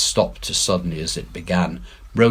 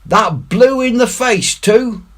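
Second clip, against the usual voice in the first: fearful pirate; 6 dB louder than usual. A narrator's reading. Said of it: "with a shudder".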